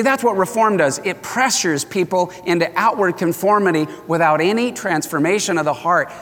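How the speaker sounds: normal talking voice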